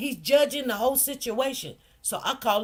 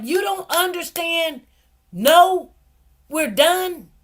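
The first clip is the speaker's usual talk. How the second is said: loud, higher mock judge yelling; judge hollering; 6 semitones higher, 7 dB louder, slower than usual. Judge hollering at the mother in court, loud and higher. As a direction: bark it like an annoyed judge shutting her down. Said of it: "the judge was hollering at me".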